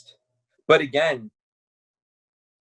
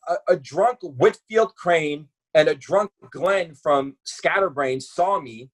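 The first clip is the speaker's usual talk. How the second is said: usual speaking voice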